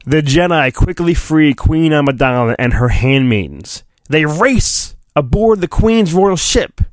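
normal talking voice

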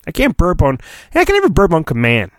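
ordinary delivery

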